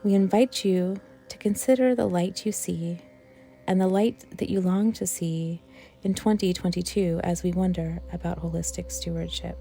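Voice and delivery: ordinary talk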